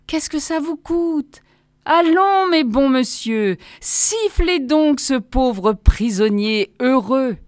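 high, slow, drawn-out pleading; pathetically vibrating voice. A narrator's reading. Prisoner begging good gentlemen to whistle, voice pathetically vibrating. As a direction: wheedle pitifully, stretching the words up high. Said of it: "faisant vibrer pathétiquement ma voix".

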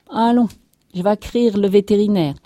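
high, loud rustic announcement; resolute farmer. A narrator's reading. Farmer off to fetch the vet, resolute. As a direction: announce it briskly and loudly.

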